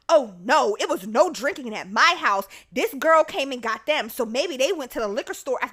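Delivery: loud, emphatic retold protest; defensive denial. Other girl's mother denying drinking at her house, loud defensiveness. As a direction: insist loudly, shifting the blame.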